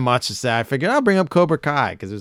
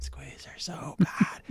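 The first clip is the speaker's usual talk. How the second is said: slow breathy whisper; 6 semitones higher, 12 dB quieter, slower than usual. Acted. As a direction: whisper it slowly, just air. Whispered aside to the snake.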